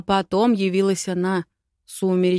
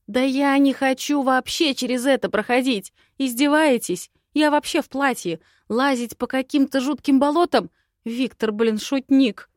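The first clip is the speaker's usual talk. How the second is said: indignant protest; 7 semitones higher, 6 dB louder than usual. Acted. Heroine protests the quest.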